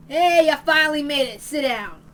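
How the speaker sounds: loud, hard hello to latecomers; gruff loud greeting. Acted. Man calling the late newcomers over, loud and gruff. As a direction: bark the welcome like a thug.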